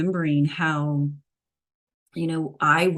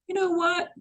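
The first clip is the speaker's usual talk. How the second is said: higher-pitched acted declaration of being done; mock fed-up; 6 semitones higher than usual. Imagined woman deciding on explant, mock fed-up. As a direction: voice a sudden high-pitched resolve. Acting out abrupt decision to explant.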